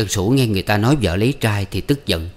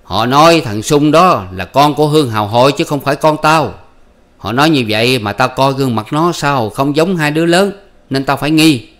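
raised, higher accusing husband voice; husband accusing his wife, higher and louder; suspicious husband; 5 semitones higher, 6 dB louder than usual. Acted.

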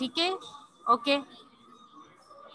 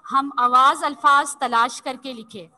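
each word read slowly and clearly; slow dictation; slower than usual. Shown as a demonstration. Teacher reading an exercise to children, slow dictation. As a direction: read the task out slowly so pupils follow. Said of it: "reading the task aloud".

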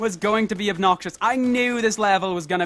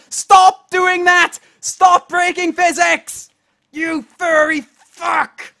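slow, drawn-out furious yelling, much higher and louder; every word stretched and bellowed; furious shout; 8 semitones higher, 7 dB louder, much slower than usual. Real, not acted. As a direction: scream at the rabbit in pure rage. Raging at the game.

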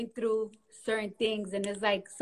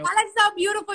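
gushing; 8 semitones higher, 7 dB louder than usual. Real, not acted. Gushing about the video.

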